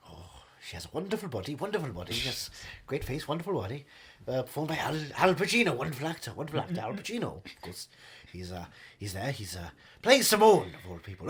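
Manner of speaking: low, soft, rambling luvvie; wistful veteran actor. Acted. Actor reminiscing about his film co-stars, low and soft. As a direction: murmur fond praise like an old thespian.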